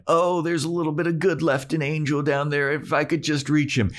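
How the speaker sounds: high, put-on wishful voice; mocking hopeful thought. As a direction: mimic a naive hope in a mocking high voice. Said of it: "There's a bit of you that's thinking".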